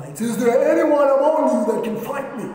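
loud, high-pitched giant's dare; booming challenge. Acted. Goliath challenges the children of Israel, loudly defiant. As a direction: throw the challenge out to a whole army.

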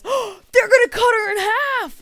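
put-on very high, very loud gasping kid; excited little boy. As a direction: yell it like a thrilled boy. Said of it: "And then he says".

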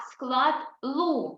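"лу" drawn out slowly, every sound clear; slow model syllable. Shown as a demonstration. Teacher models "лу" for the class to repeat, very slowly. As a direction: stretch "склад лу" so children can copy. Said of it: "Повторіть, будь ласка, за мною".

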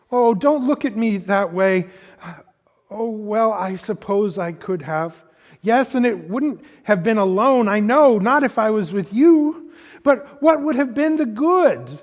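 put-on a guilty child begging not to be blamed; young Lucy, pleading. A narrator's reading. Reading Lucy's plea to the lion, high and anxious.

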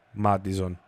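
usual speaking voice

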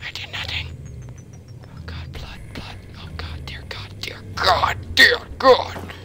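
unvoiced, frightened whispering; terrified whisper. Real, not acted. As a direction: whisper a prayer in terror. Terrified in dark.

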